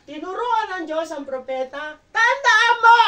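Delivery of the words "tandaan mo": sudden loud preacher shout, pitch way up; shouted command. Acted. Pastor voicing God's warning to the prophet, shouted. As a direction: bark it out so they remember. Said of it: "Ako lang yung sumisigaw".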